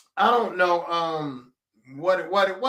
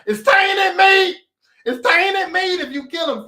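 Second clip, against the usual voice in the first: high, loud, slow indignant yell; mock outraged shout; 10 semitones higher, 7 dB louder, slower than usual. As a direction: bellow it like a furious butcher. Retelling the organ harvesters' complaint.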